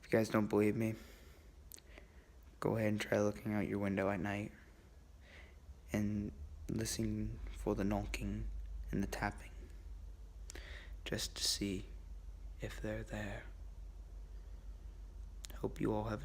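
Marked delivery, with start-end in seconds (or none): 2.6-13.4 hushed spooky. Real, not acted. Spooky dare to viewers.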